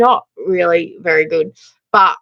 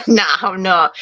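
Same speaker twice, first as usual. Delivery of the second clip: ordinary talk, nothing marked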